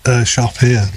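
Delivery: ordinary talk, nothing marked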